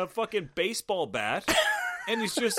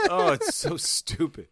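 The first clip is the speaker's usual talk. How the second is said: ordinary talk, nothing marked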